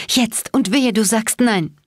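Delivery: high, strained, urgent demand; croaked demand. A narrator's reading. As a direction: croak it out as a threat. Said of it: "krächzte ich".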